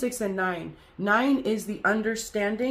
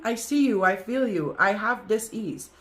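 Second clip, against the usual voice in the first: ordinary talk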